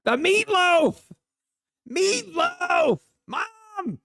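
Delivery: put-on high, loud kid voice wailing; mock child wail. Trader hamming up a kid's meatloaf wail. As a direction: holler it like a kid at dinner.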